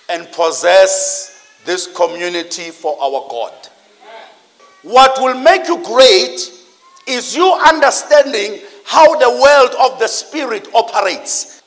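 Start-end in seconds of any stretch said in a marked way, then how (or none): none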